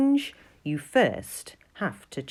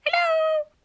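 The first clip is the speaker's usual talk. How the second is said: high tiny mouse voice calling out; squeaky mouse hello; 5 dB louder than usual. Acted. The mouse calls out to nobody, high squeaky hello. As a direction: pipe up like a mouse nobody hears.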